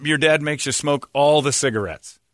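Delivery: ordinary talk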